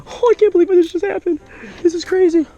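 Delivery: high, loud squealing excitement; ecstatic disbelief. Real, not acted. Angler who just landed one of his biggest fish, squealing with joy. Overwhelmed by his huge catch.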